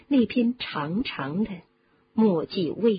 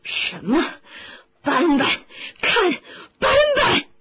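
loud outburst climbing to a high scream; distraught yelling; 9 semitones higher, 6 dB louder than usual. Acted. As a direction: shout in anguish, each word higher. Ron raging over his rat.

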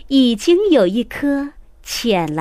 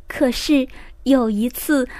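ordinary delivery

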